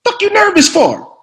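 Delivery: loud, high, exasperated star actor; Sam Jackson impression. Veteran actor challenging the newcomer's nerves, exasperated impression. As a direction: berate the rookie in disbelief. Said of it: "He said".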